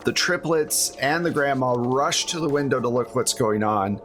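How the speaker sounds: usual speaking voice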